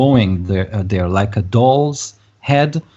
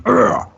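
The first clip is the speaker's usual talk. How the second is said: short growled cry of pain; pained grunt. Acted. Game master voices stabbed man, shout of pain. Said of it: "he shouts out in pain".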